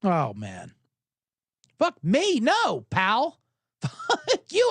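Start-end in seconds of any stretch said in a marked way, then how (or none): 1.8-3.4 high mock outrage; acted indignant comeback. Golfer answers the cursing driver, mock indignant. 3.8-4.7 very high mock-outraged curse; acted angry retort. Golfer cursing back at the driver, mock outrage.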